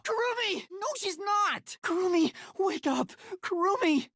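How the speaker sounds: high urgent calls; calling out. Acted. Waking the unconscious Kurumi, high desperate calls. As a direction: shout her name to wake her.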